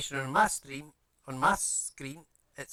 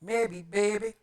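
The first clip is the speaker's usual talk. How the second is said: playful put-on voice; 7 semitones higher, 4 dB louder, slower than usual. Playful put-on voice while building.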